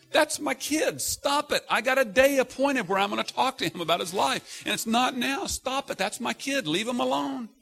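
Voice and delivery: put-on the Lord defending his child from judges; protective Lord. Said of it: "the Lord's going".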